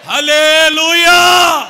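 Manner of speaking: long, high, shouted hallelujah; loud hallelujah. Real, not acted. Pastor leading the crowd, shouting hallelujah. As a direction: lift your voice all the way. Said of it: "ఇంకొంచెం స్వరం ఎత్తుదామా".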